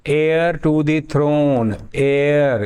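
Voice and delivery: heir to the throne, drawn out word by word; slow model reading. Shown as a demonstration. Teacher reading the phrase to the class, very slow. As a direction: read it very slowly so the class hears "heir". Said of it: "slow model reading".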